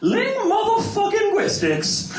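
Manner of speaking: Ling motherfucking guistics stretched slow and high with a laugh; drawn-out infix demo. Shown as a demonstration. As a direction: savour the motherfucking inside guistics. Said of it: "it all comes down to linguistics".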